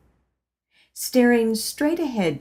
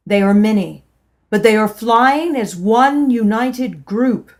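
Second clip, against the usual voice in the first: loud baritone prophecy; 7 dB louder than usual. Retold or imitated. Churchgoer telling the congregation his vision, loud proclaiming. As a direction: ring it out like a prophetic message. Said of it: "a man's loud baritone voice rang out".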